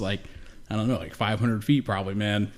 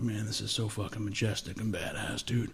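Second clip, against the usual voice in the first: softly voiced amazement, almost to himself; hushed awe; 8 dB quieter than usual. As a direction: breathe it out like a stunned tourist. Awed at the cliff view.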